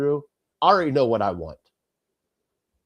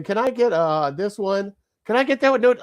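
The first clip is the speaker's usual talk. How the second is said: put-on much higher, dragging kid order; mock kid voice; 7 semitones higher than usual. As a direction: draw it out like an undecided kid. Imitating family member's order.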